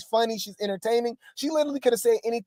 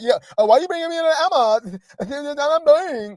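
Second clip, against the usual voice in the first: a bit higher and faster, a hint of mock whine; mocking Rella; 4 semitones higher than usual. Streamer lightly mimics Rella denying she's boring. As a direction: play her up lightly, half-laughing. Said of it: "She like".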